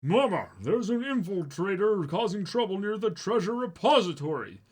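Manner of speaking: high little messenger voice; squeaky messenger. Acted. Messenger warning Mama about the infiltrator. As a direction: report urgently like a tiny servant. Minion reporting over radio.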